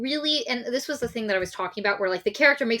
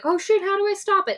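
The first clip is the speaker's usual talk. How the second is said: much higher, louder panicked squeal; mock panic; 12 semitones higher, 4 dB louder than usual. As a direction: yelp it like a freaked-out teen. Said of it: "she's like".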